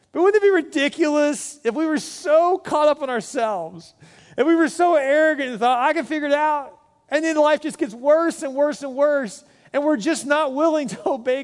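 mock disbelief at the congregation's stubbornness; incredulous preaching. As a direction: preach it like it's absurd. Mocking our own arrogance.

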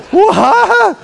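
very high, loud cry of disbelief; hammed-up stunned squawk; shocked outburst. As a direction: yelp it as if blindsided. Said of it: "He's shocked".

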